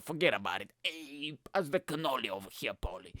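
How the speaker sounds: mock mobster asking about the cannoli; lightly voiced Italian-American gangster. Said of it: "Really stereotypical".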